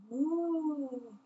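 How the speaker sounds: a gentle magical ooh; whispery ooh. A narrator's reading. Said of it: "appeared with a whispery".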